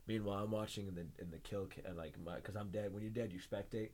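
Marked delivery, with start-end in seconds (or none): none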